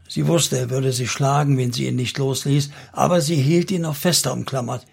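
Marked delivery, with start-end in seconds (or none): none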